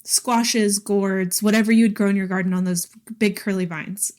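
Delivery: normal talking voice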